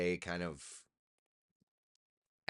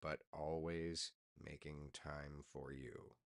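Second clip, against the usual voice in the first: slower and much softer; soft slow reply; 5 semitones lower, 13 dB quieter, slower than usual. Retold or imitated. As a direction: say it gently, taking your time. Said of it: "I am".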